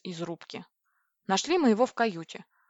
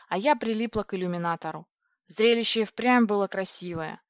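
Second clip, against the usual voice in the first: ordinary delivery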